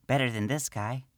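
high small gnome woman's voice, matter-of-fact; gnome priestess. Acted. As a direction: speak as the little priestess.

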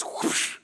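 airy, voiceless whooshing noise; breathy sound of something swooping back; imitated whoosh. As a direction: blow out a quick rushing whoosh. Imitates the worry rushing back.

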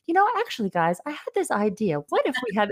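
high-pitched quote of an actor piping up; imitated actor's pitch. Quotes actor breaking from the thug voice.

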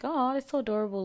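high, quick mock coo; imagined adoring reaction. Woman retells her silent reaction to guys' accents, cooing. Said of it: "I was just like".

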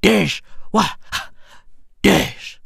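usual speaking voice

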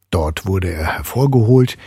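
usual speaking voice

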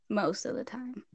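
teasing whispered repeat; sly whispered echo; whispered aside. Real, not acted. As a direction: drop to a whisper to tease.

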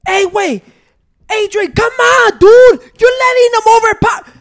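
high, loud mock coaching yell; imitating sideline yelling. Brother yelling at the kid on the field, high mock shout.

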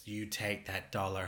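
normal talking voice

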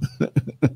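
short trailing chuckle; amused chuckle. Real, not acted. New Yorker amused at being recognized by accent, short chuckle. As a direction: chuckle on after your own joke. Laughs after the accent quote.

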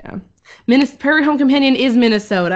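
usual speaking voice

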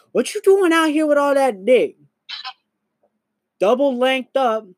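high, put-on heckler voice calling out; hammed-up high gawker voice; mock onlooker heckle. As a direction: tease him like a passerby catching him half-naked. Mocking onlooker gawking at him.

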